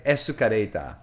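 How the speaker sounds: "escareta" with a Japanese r for l and open vowels; Japanese pronunciation. Shown as a demonstration. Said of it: "escalator in Japanese is escareta".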